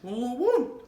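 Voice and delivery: high whooping cry of delight. Real, not acted. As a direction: whoop over how good it tastes. Overwhelmed by the taste while eating.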